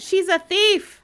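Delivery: high, loud accusing shout. Acted. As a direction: yell it at her, pointing. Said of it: "began shouting".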